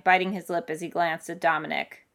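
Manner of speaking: plain reading voice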